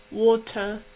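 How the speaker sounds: badly faked British "water"; British water with the R dropped; bad British accent. Shown as a demonstration. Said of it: "That was a really bad British accent".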